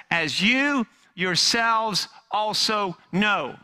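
slow, weighty proclamation; slow deliberate Peter. Acted. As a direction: lean on every word like Peter to the crowd. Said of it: "He pauses and he says".

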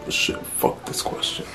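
ordinary talk, nothing marked

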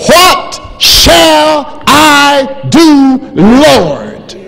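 high, loud, very slow shout; Saul shouting. Acted. Saul on the road, shouting to the Lord for orders.

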